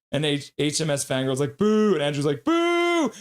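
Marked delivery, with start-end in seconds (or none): 1.6-1.9 loud, higher crowd boo; drawn-out boo. Retold or imitated. Host acting out a fangirl's chat message, booing. 2.4-3.1 very high, held-out boo; high mock boo. Host acting out another viewer's chat, booing high.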